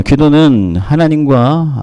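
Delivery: usual speaking voice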